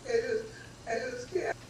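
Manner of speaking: half laughing, half crying, voice cracking high; tearful laugh through the words; choked-up laugh-cry. Retold or imitated. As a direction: break up as you say goodbye. Retelling goodbye to grandmother.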